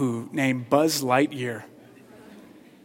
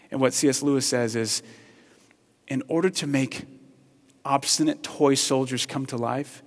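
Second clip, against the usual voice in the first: ordinary delivery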